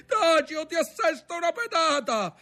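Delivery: high, angry yell; shouted threat. A narrator's reading. Brother threatening the screaming boy, shouting angrily. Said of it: "gli gridò il fratello".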